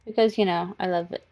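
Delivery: normal talking voice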